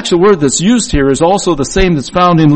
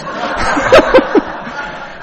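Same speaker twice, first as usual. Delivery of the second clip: short laugh. Real, not acted. Laughs at the count.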